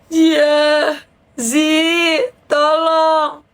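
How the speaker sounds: high, drawn-out wail for help; pleading friend. Retold or imitated. Rich friend phones the speaker for help, pleading. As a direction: imitate a whining, desperate caller.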